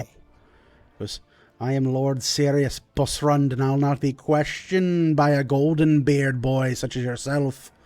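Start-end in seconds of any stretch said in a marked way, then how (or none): 1.6-7.7 proud lord pulling rank; indignant dwarf lord. Acted. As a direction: put an upstart in his place. Game master plays the Lord.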